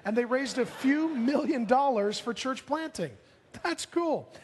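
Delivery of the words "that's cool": high, bright-eyed delight; excited. Real, not acted. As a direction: toss it up with real glee. Reacting to millions raised.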